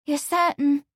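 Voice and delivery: high, tentative little question; pretty hesitation. A narrator's reading. Amelia wants Lawrence to confirm, hesitant. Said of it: "with a pretty hesitation".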